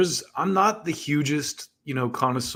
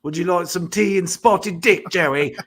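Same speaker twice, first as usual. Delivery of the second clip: a hammed-up British accent, higher and louder; mock British accent; 6 semitones higher, 5 dB louder than usual. Host teasing Joey with tea and spotted dick, in a mock British accent. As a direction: put on a jokey posh British accent for the tea offer. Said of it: "anytime I do a British accent".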